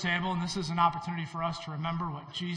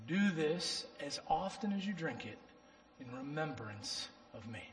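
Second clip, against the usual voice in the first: slow, hushed solemn reading; scripture reading; 5 dB quieter than usual. Acted. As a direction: read the Lord's words with reverence, fading softly. Reads the communion scripture.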